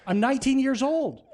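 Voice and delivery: very high, loud cry of disbelief; panicked protest. Retold or imitated. Teenage intern sent to face a stadium crowd, panicked protest. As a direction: wail it, pleading your youth. Protest repeated, now frantic.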